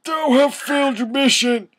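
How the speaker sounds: high, strained villain rasp; evil raspy voice. Acted. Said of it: "said evilly in his raspy voice".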